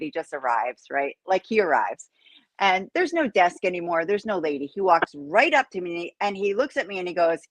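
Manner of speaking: normal talking voice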